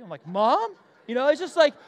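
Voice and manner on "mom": startled mom. Retold or imitated. Startled reaction to wife.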